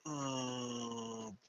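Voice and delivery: usual speaking voice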